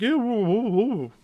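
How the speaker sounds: put-on high silly drunken woo. Drunk student whooping nonsense at the frat brothers, high and silly. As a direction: whoop like a dazed partier. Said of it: "the dumbest words ever".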